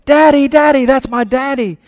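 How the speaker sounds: put-on high, loud little girl's scream; child screaming daddy. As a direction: scream it like a three-year-old spotting her father. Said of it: "still yelling".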